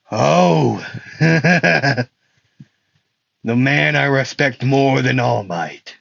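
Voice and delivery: hammed-up villain with a chuckle; chuckle, then draw the words out slowly; Stain. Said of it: "he doesn't sound like that".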